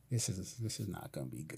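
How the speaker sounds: low, very soft aside to oneself; retold thought, kept low and under the breath; inner thought. Officer watching the fence climb thinks to himself, very quietly.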